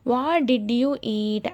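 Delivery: each word spaced out very slowly; slow dictation. Shown as a demonstration. Teacher reading an example question to learners, very slow. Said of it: "అనే క్వశ్చన్కి తెలుగులో అర్థం".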